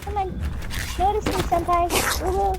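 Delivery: high pleading fangirl whine; excited anime fan. Pretend. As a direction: beg sweetly like an anime fan. Begging creature to notice her.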